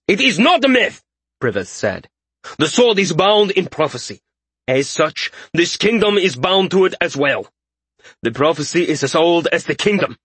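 high-pitched, fervent insistence; fanatic king. A narrator's reading. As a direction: proclaim prophecy with a zealot's fervor. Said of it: "now brooded a fanatic".

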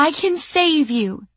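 high, desperate pleading voice; high pleading woman. Acted. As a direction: beg through the door. Sandy pleading at the door.

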